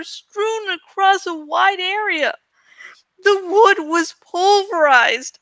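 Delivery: lively read-aloud storytelling; story narration. A narrator's reading. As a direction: narrate the adventure with drama. Reads novel aloud.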